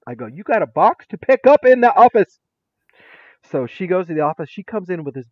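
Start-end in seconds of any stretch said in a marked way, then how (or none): 0.3-2.4 high, loud mock announcement; gleeful loud repeat. Teacher to student about her surprise box, loud announcing. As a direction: belt it out, higher and louder.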